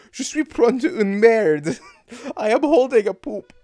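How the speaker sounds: high, loud joke delivery cracking into laughter; laughing. Real, not acted. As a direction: blurt it out, barely holding back laughter.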